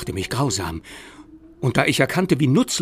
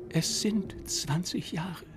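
slow, softer, higher lament; 7 semitones higher, 7 dB quieter, slower than usual. Acted. Ghost child laments to Lockwood, slowly and softly. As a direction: mourn every word. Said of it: "klagte die Stimme".

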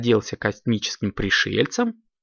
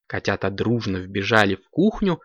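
ordinary delivery, nothing marked

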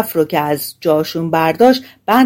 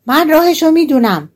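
higher-pitched, raised voice; calling out; 8 semitones higher, 3 dB louder than usual. A narrator's reading. Tiny hero tells the thieves he knows the way, calling out. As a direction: pipe up loudly. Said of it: "با صدای بلند گفت".

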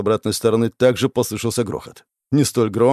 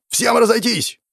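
shouted order; 12 semitones higher than usual. Acted. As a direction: yell to scatter. Commander orders retreat.

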